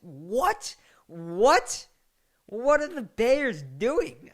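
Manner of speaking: high, loud, drawn-out disbelief; slow, high shout of disbelief at the Bears; incredulous outcry. Real, not acted. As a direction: shout it like you can't believe it. Incredulous outburst at the picks.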